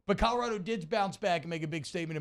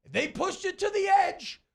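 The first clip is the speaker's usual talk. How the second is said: excited; 14 semitones higher, 5 dB louder than usual. Real, not acted. Tense close game recap.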